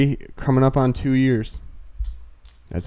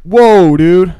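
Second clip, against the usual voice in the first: high, loud mock exclamation; big raised-pitch imitation of a catchphrase; Chris Rock; 6 semitones higher, 9 dB louder than usual. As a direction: exclaim it loudly as an impression. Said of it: "I just did".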